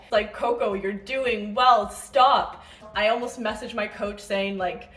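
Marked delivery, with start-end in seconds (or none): 0.3-2.6 slow, loud, emphatic imitation of boyfriend; firm reassurance. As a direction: imitate a boyfriend putting his foot down. Boyfriend cuts off her worrying.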